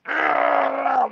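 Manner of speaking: high-pitched strained grunt; drawn-out mock grunt of effort; straining grunt. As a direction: imitate someone bearing down hard. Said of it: "He just grunts".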